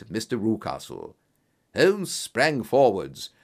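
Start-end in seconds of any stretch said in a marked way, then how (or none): none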